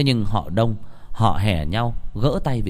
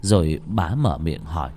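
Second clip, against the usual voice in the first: plain reading voice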